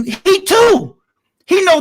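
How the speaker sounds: loud, high exclamation; exasperated outburst. Real, not acted. Panelist objecting to reasoning with a small child, exasperated outburst. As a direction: burst out in high-pitched disbelief.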